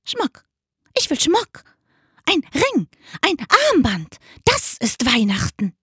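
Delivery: high, croaky magpie demands; greedy magpie, high-pitched; squawking magpie. A narrator's reading. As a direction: squawk it in short bursts like a magpie. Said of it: "die Elster krächzte".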